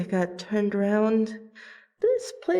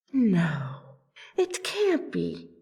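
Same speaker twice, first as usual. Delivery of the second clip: stunned disbelief; 3 semitones lower, slower than usual. Acted. Stunned on recognizing the name.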